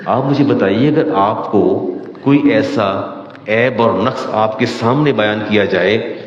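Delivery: ordinary talk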